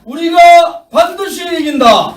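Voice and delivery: high shouted slogan; roaring rallying speech. Real, not acted. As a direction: lead the final chant. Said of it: "자, 제가 하겠습니다". (힘찬 선창)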